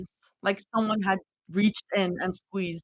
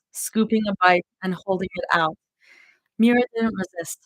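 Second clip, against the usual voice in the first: ordinary delivery, nothing marked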